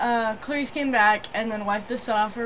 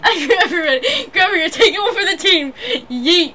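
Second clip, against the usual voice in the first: loud, giggling high repeat; laughing; 7 semitones higher, 8 dB louder than usual. Real, not acted. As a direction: laugh through the words. Laughing, repeating friend's line.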